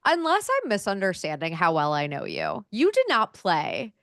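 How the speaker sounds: ordinary talk, nothing marked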